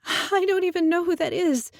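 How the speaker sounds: high, loud frightened protest; panicked. A narrator's reading. Girl protesting to the Minotaur, high and panicked. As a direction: squeal it out in panic.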